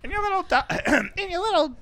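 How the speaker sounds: high, faltering witch impression; half-finished movie witch voice; Wicked Witch. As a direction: attempt the witch's high voice and break off. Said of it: "That's the Wicked Witch".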